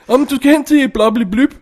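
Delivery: high, loud nonsense line; mock film character. Character giving nonsense directions, mock silly. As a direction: imitate a silly, baffling character. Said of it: "det er altså bare sort".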